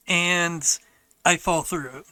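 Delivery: ordinary talk, nothing marked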